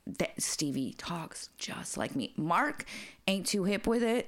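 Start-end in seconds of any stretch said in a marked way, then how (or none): 0.1-2.3 soft unvoiced whisper, lower and softer; whispered aside. Real, not acted. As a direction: lean in and whisper it like a secret. Aside about daughter's cussing.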